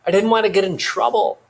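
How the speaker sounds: put-on high-pitched, flustered girl's defence; upset protest. A narrator's reading. Girl defending herself, near tears.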